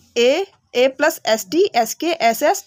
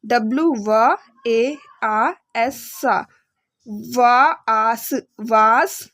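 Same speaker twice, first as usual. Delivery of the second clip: sounding out letter by letter; 2 semitones lower, much slower than usual. Shown as a demonstration. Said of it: "इसी तरह से".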